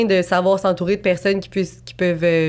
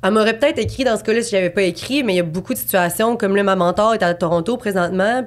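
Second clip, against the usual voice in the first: normal talking voice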